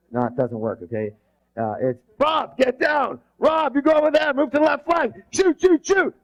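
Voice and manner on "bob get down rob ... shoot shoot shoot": high, urgent battlefield shouting; shouted battle orders. Acted. Leader ordering squad under fire, urgent high shouting. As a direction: yell commands over gunfire. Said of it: "imagine in battle, bullets are flying".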